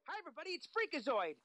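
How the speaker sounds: zany cartoon hero, very high voice; high manic promo rising to squealed shouts; Freakazoid. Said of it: "word from our good friend, Freakazoid".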